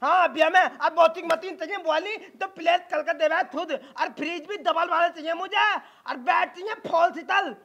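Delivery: lisping son stubbornly reeling off demands; childish lisp. Acted. Groom lists his dowry demands, lisping. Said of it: "ये तो तोतला है".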